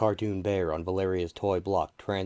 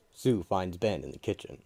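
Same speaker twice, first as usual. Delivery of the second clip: usual speaking voice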